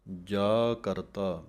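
slow ਜਾ ਕਰਤਾ, then a clear stop; ਜਾ ਕਰਤਾ drawn out with a falling end, then a pause; pause after ਕਰਤਾ. Shown as a demonstration. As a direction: recite ਜਾ ਕਰਤਾ slowly and hold a pause before going on. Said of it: "ਇੱਥੇ ਥੋੜ੍ਹਾ ਰੁਕ ਕੇ".

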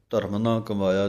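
ਧਰਮ ਨਾ ਕਮਾਇਆ fast, run together; rushed, wrong. Shown as a demonstration. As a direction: hurry through it carelessly. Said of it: "ਨਹੀਂ ਕਹਿ ਦੇਣਾ". (ਕਾਹਲੀ ਨਾਲ ਗਲਤ ਪਾਠ)

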